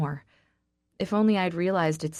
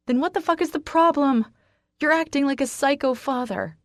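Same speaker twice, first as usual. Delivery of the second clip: much higher, loud furious yell; 9 semitones higher, 5 dB louder than usual. Acted. Woman yelling at her friend who acts like a father, furious. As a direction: explode at him. Climax of the quarrel.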